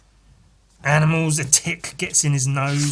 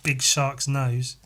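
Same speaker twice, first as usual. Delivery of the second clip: ordinary talk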